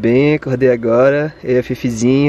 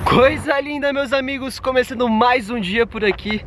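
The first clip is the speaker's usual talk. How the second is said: upbeat, higher intro to camera; vlog intro; 9 semitones higher than usual. Acted. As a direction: greet your viewers brightly.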